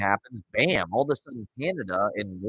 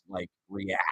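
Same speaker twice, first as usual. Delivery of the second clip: normal talking voice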